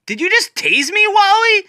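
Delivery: startled cry of a friend who just got tased; shocked outburst. Acted. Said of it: "Nightwing says".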